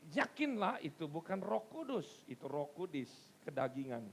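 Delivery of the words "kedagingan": ordinary talk, nothing marked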